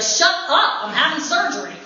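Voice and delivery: high mock-annoyed snap; grumpy patient. Other patients scolding the noisy pair, mock-annoyed and high. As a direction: play the irritated patient. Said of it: "people would be like".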